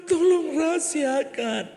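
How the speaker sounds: high, loud begging voice; high pleading woman. Acted. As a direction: plead up high like a desperate wife. Wife pleading. (istri memohon ke dokter dengan suara tinggi)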